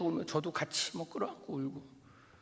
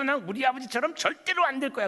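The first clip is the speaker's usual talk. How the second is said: higher, louder, bitter vow; defiant son; 9 semitones higher, 6 dB louder than usual. Pretend. Imagined grown son swearing never to become his father. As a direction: spit it out like a resentful teenager.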